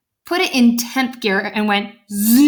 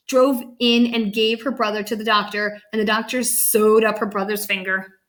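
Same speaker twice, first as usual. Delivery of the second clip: ordinary delivery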